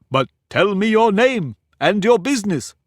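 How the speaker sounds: put-on pompous egg lecturing a girl; haughty egg. Humpty demands Alice's name.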